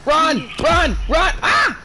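frantic high yells to run; shouting. Real, not acted. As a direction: yell in a panic, pitch shooting up. Yelling at teammate to flee.

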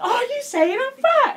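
high, slow, drawn-out demand; mock-furious interrogation, pitch way up; aggressive. Daughter confronting dad about being fat, aggressive. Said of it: "Really aggressively".

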